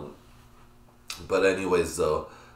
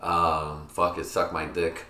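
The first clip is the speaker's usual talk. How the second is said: usual speaking voice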